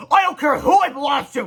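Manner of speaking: high, loud shout; high yelled interruption. Acted. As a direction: cut in with a yell.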